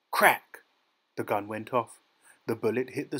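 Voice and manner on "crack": sudden high, loud burst; gunshot crack. A narrator's reading. Gun goes off at the swan, sharp loud crack. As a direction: fire the word like a shot.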